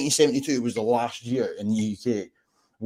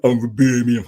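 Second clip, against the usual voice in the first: mock Brummie deep voice; 8 dB louder than usual. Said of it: "the really deep voice".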